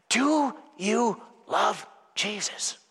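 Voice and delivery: soft searching question. Acted. Quiet searching question to congregation.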